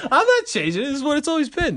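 high-pitched protest through laughter; laughing protest. Real, not acted. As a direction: insist while cracking up. Laughing protest over changed slogan.

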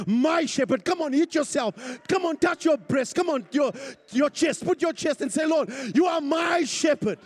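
loud, high, rousing preacher; full-force preaching. Real, not acted. Preacher urging congregation to declare the psalm, full-force preaching. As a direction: drive the congregation to claim it aloud.

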